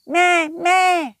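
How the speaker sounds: high, loud bleating sheep. Acted. Shaki bleats.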